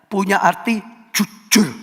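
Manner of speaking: ordinary delivery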